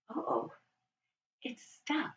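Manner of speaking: hushed uh-oh, whispered; worried whisper. Pretend. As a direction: whisper it like a secret problem. Door will not open.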